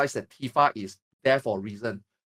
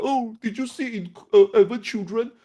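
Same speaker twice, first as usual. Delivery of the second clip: mocking fan voice; 6 semitones higher than usual. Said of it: "people is still like".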